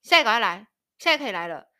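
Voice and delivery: loud, bossy command to come now. Retold or imitated. Mother-in-law ordering them over right now, commanding. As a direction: bark it like an order from above. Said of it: "然后送命令那种".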